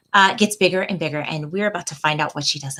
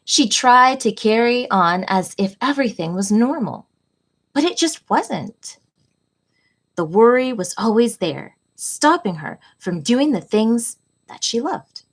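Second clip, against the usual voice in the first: gentle, expressive picture-book read-aloud, often higher; reading to children; 4 semitones higher than usual. A narrator's reading. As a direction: read it like storytime for little kids. Reads picture book to class.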